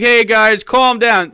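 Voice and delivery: high loud mocking plea. Mocking the agitator asking the crowd to calm down. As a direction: mimic him begging for calm. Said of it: "And he's like".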